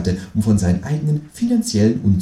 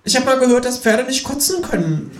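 lecturing kangaroo. Acted. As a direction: share a stoned theory. Kangaroo lectures while smoking. (dozierende, hohe Känguru-Stimme)

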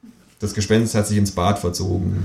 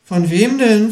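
high, louder startled question; 13 semitones higher, 5 dB louder, slower than usual. A narrator's reading. Kangaroo asking the ghost, startled and high. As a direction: jump at the news, high and loud. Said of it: "fragt das Känguru erschrocken".

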